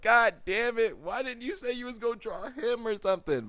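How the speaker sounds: high-pitched comic wail; exasperated complaint. Acted. Man inwardly scolding his friend over the drawing, exasperated. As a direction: complain loudly in a squeal of dismay. Acts out dismay at her remark.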